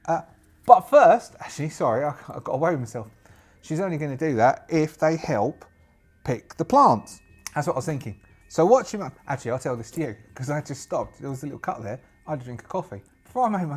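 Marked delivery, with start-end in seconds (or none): none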